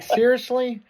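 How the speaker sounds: disbelief. Retold or imitated. Manager reacting to the stoner's meeting speech, incredulous. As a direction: let the disbelief pitch way up.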